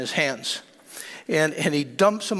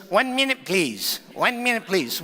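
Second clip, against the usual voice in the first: squeaky voice. Retold or imitated. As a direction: imitate a squeaky little man with little English. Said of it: "He had this squeaky voice".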